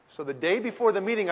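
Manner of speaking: ordinary talk, nothing marked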